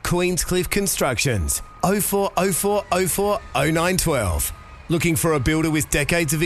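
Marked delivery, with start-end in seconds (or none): none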